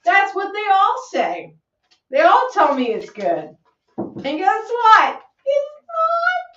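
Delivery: hammed-up indignation building to a squeak; mock outrage. Insisting to her friends that whiskey is not good, mock outraged.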